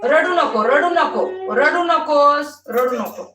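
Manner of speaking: stern scolding. Real, not acted. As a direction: tell her sharply to stop crying. Scolding the crying toddler. (रडणाऱ्या मुलीला मोठ्याने रागावणे)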